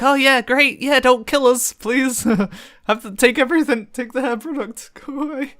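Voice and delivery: high-pitched mock pleading, laughing; hammed-up anxious agreement, breaking into laughs; nervous placating. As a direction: humour a scary man in mock fear. Acts out nervously placating the stranger.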